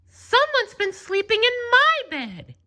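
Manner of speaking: very high, put-on Mama Bear voice; a mother bear finding her covers rumpled; medium-sized Mama Bear voice. A narrator's reading. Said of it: "she said in her medium-sized voice".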